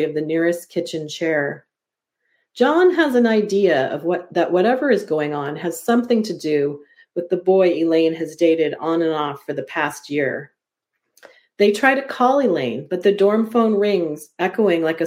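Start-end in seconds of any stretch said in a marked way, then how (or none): none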